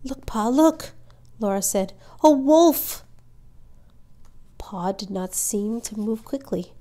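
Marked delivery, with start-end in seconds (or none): 0.0-0.9 higher, urgent warning; startled, pitched-up call to father; alarmed child. A narrator's reading. Child spots eyes in dark. 2.2-3.0 high, loud cry of alarm; pitched-up, far louder alarm; alarmed child. A narrator's reading. Child spots a wolf.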